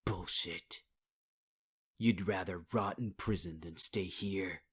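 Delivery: ordinary delivery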